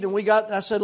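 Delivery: ordinary delivery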